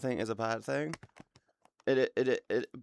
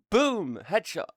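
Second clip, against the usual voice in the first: louder, higher boom; gamer shout; 6 semitones higher, 4 dB louder, slower than usual. Real, not acted. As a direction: celebrate the headshot. Game headshot.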